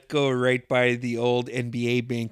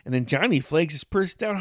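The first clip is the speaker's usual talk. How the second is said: ordinary talk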